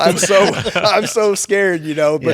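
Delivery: high, loud and slow, laughing through it; real laughter at his own terror; laughing scared. Real, not acted. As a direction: laugh out how scared you were.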